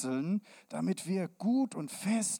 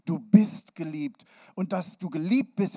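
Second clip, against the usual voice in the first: normal talking voice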